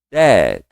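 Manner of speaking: "Dad" with just a small tap on the last d; barely touch the final d of "Dad"; light final d. Shown as a demonstration. Teacher shows the light tap on dad. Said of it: "Só um toquinho no D".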